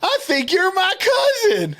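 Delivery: high, drawn-out retold cry of delight; excited recognition. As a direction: imitate a thrilled relative, stretching it out high. Retells stranger recognizing his cousin.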